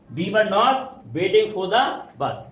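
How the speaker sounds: very slow, word by word; deliberate dictation pace; slow reading aloud. Shown as a demonstration. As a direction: read it out slowly for the class. Teacher reads sentence for class.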